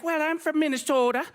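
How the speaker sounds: imitating his wife's Minnesota accent to the Uber driver. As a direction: lightly put on her Minnesota vowels. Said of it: "what is going on with these accents".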